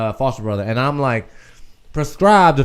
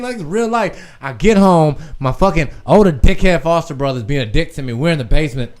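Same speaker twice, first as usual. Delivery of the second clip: normal talking voice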